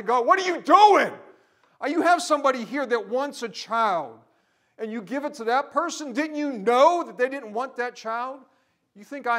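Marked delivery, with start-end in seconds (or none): 0.0-1.1 high, loud anguished shout; angry cry to God. Retold or imitated. Preacher reliving his fist raised to God, a loud anguished shout. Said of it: "shake my little fist at God and say". 1.8-8.4 loud, high, impassioned sermon shout; preaching at full force. Acted. Preacher raging at injustice before the congregation, at full force.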